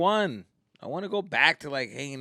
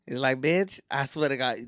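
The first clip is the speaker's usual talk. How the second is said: ordinary talk, nothing marked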